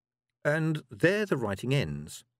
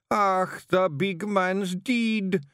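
higher, slow mock sob; hammed-up Scottish wail; comic grief; sobbed; 7 semitones higher, much slower than usual. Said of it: "he sobbed".

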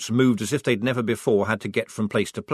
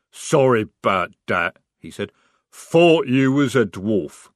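troll voice; 6 dB louder, slower than usual. Acted. Troll speaks.

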